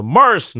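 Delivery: "マース" with a drawn-out English R; American AR sound. Shown as a demonstration. Host back from America, showing off R-coloured "マース". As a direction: show off a curled R in "マース". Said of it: "エーアールの発音がもう本場".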